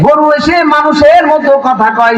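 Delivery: put-on high, urgent child's voice running to his mother; a boy breathless with news, pitched high; excited boy. Son runs to mother with news of a talking cow, high and urgent. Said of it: "ছেলে দৌড় দিয়ে আমার কাছে".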